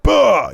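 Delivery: high, loud "bah" outburst; mock angry bellow. Briggs hearing he can't wrestle, mock angry bellow. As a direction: burst out like a furious wrestler. Imitating Briggs getting pissed.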